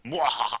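mock evil laugh.

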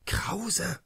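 a hushed, urgent whisper of the name; nervous whisper. Acted. Doktor Clusius jumps up nervously and whispers to Krause. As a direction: breathe the name out, rattled. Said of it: "sprang nervös auf".

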